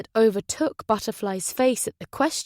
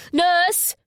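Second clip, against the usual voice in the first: much higher, louder shout for help; 9 semitones higher, 5 dB louder than usual. Acted. Jack calling nurses to the bed, urgent shout. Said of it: "he shouted".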